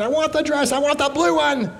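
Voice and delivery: put-on high, whiny child wailing for a dress; mock tantrum, high and whiny; screaming little girl. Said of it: "She was screaming and crying".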